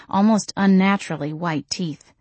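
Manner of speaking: ordinary delivery, nothing marked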